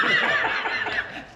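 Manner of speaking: breathy burst of laughter; real laugh. Real, not acted. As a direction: laugh out at your own remark. Speaker laughs after his own remark.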